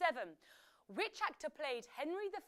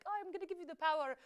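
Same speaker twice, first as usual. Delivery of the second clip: quicker, higher mock-generous king; lightly acted King Lear; 5 semitones higher, faster than usual. Lear to his daughters, lightly acted and higher. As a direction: lightly play an old king handing over. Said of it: "he was like".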